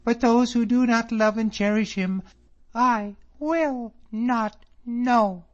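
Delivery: high, loud, proud reproach; indignant cry. A narrator's reading. Miss Drummond rebuking David over her father, high indignant cry. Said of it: "she cried out".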